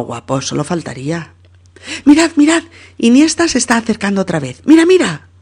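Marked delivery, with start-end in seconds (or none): 2.0-5.3 agudo y fuerte, rápido; emoción de hincha. Acted. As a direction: avisar a todos de la jugada con emoción. Jugada de peligro emocionante. (hincha emocionado, agudo y rápido)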